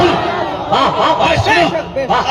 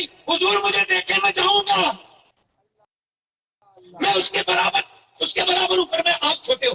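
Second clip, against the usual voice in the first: higher, lightly voiced eager boy; pleading small boy; 6 semitones higher, 3 dB quieter than usual. Young boy begging the Prophet, higher and eager. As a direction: stretch up and plead to be taken.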